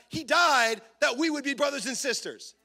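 usual speaking voice

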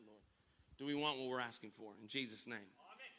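soft, low prayer. Acted. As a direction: pray quietly over them. Leads closing prayer.

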